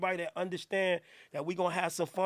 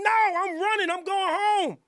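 loud panicked joking; 10 semitones higher, 6 dB louder than usual. Real, not acted. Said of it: "reacting to his own bit".

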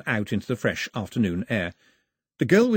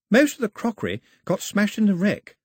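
sad; 11 semitones higher, 4 dB louder than usual. A narrator's reading. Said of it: "said the girl sadly".

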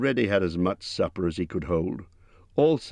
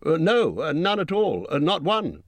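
flustered hobbit; 11 semitones higher than usual. A narrator's reading. Bilbo backtracks.

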